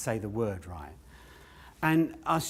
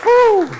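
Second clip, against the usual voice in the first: loud relieved whew; 16 dB louder than usual. Acted. Relief after cursing.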